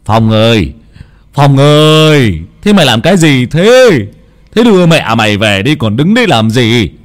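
put-on old Long calls his son from a distance; calling from afar. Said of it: "giọng của ông Long vang lên". (ông Long gọi to từ xa)

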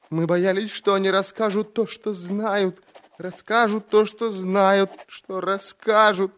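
high, strained, fearful confession; terrified babbling. A narrator's reading. Prisoner confessing his fear to the inquisitor, terrified babbling. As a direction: repeat yourself in a high, panicked voice.

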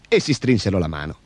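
ordinary delivery, nothing marked

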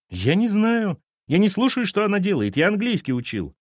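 put-on high, rising teenage protest; defensive boy. Boy to captain, high and stroppy. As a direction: brush off the question.

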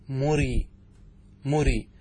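each مري as smooth "murii"; long ee straight after the r in مري; no glottal stop inside مري; hamza dropped. Shown as a demonstration. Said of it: "ثم حذفت الهمزة فأصبحت".

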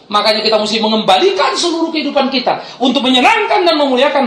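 high, loud pulpit declaration; impassioned preaching. Real, not acted. Preacher urging the congregation to trust God's care, impassioned. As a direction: drive each phrase up to a ringing peak.

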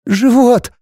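высокий жалобный женский голос; проскули высоко, как от боли; скуля, плача. A narrator's reading. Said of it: "проскулила она, плача". (плаксивый болезненный возглас)

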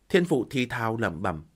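ordinary delivery, nothing marked

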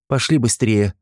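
ordinary delivery, nothing marked

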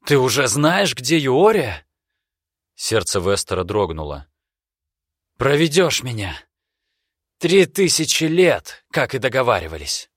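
put-on high, loud urgent young man. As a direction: demand it breathlessly. Vester voiced in story.

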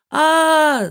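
long, high battle scream; high drawn-out yell; loud scream. A narrator's reading. Heroine hurling the casket to the floor, loud scream. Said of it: "закричала я".

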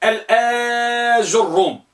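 الآج الروم slowly, long آ and doubled ر; heavy doubled ra. Shown as a demonstration. Teacher modelling the fourth type, slow and stretched. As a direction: hold آ, then lean on ر in الآج الروم. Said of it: "مع تشديد وت".

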